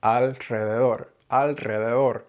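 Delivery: Alrededor with air forced out after the l; breathy trilled r in alrededor; rolled r after L. Shown as a demonstration. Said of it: "really force air out of my mouth after the L".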